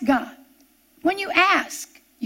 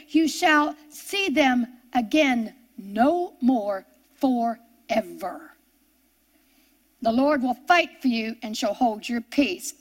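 reassuring promise; 4 semitones lower, slower than usual. Acted. Quoting Moses, slowing and softening.

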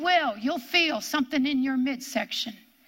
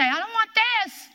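higher-pitched mock protest; 9 semitones higher, 3 dB louder than usual. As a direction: balk at it, pitch jumping up. Acted protest at God's situation.

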